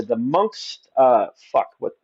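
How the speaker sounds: ordinary delivery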